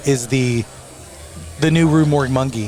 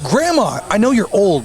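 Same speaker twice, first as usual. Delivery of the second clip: higher, mock-scolding tone; scolding grandma; 6 semitones higher than usual. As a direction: chide her gently but firmly. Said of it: "I'm like".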